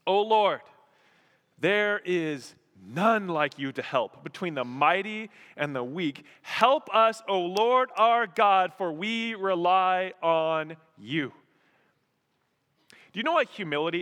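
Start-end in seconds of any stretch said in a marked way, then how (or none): 0.0-11.3 raised, deliberate plea to God; impassioned prayer read aloud, slow and strong; crying out in prayer. Retold or imitated. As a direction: cry out to the Lord, weighting each phrase. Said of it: "He cries out to him".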